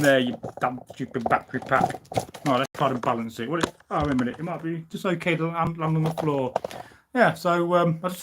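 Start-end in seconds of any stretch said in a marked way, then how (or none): none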